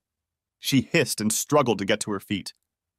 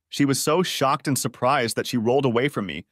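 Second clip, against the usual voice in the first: usual speaking voice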